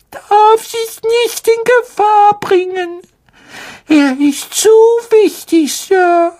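sehr hohe, langsame, weinerliche Elfenstimme; verzweifelter Hauself. Acted. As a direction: eindringlich warnend, den Tränen nah. Dobby voiced by narrator. (hoher, flehender Elf mit Tränen)

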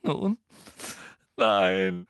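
halting, mock-tearful denial; sobbing. A narrator's reading. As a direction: sob out a hesitant answer. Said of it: "schluchzte Hermine".

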